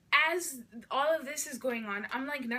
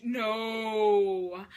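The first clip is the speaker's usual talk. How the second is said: long drawn-out, louder no; defiant refusal; 3 semitones lower, 5 dB louder than usual. Retold or imitated. Refusing to hand the phone back, long defiant no. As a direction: stretch it out in stubborn refusal.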